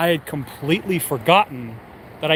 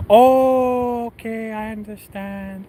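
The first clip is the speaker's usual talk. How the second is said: sad letdown; 5 semitones higher, much slower than usual. Retold or imitated. Manager turned down by applicant, mock sad. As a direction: imitate disappointment, slowing and fading away. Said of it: "she sounded kinda, like, sad".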